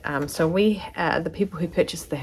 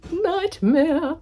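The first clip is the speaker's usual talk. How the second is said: exasperated exclamation; 4 dB louder than usual. Real, not acted. Exclaims about blazer alterations.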